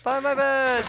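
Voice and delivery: high, loud squeaky joke voice; squeaky mock line. Friend squeaking a silly catchphrase at the others. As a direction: squeak the punchline.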